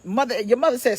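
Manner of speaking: ordinary talk, nothing marked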